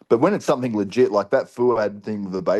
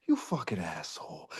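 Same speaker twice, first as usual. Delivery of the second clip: soft, low retold voice note; endearing insult; 4 semitones lower, 13 dB quieter than usual. Imitating a friend's voice note to him, softly endearing. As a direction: imitate a friend's fond insult, much quieter and lower. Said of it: "means it in an endearing way".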